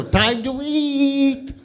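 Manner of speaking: high, slow mock call; mock sister-in-law. [name] mimicking the sister-in-law calling dinner, high and slow. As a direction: drawl it like the bossy in-law. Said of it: "your bitch sister-in-law ... go".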